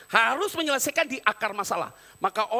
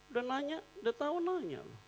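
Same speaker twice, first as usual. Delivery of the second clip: quiet aside; 11 dB quieter than usual. Real, not acted. Aside, much softer.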